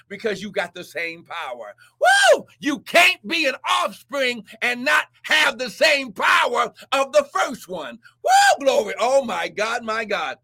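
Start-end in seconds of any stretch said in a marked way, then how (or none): 2.0-2.5 a high, loud preacher's whoop; sudden high woo of worship; ecstatic woo. Real, not acted. Ecstatic cry mid-sermon. 8.2-8.6 a high, loud preacher's whoop; sudden high woo of worship; ecstatic woo. Real, not acted. Ecstatic cry mid-sermon.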